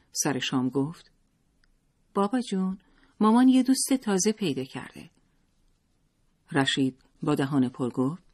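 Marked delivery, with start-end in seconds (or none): 2.1-5.1 put-on high, eager little boy; high, chirpy boy telling his father a secret; child's voice. A narrator's reading. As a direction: tell on mom like a child.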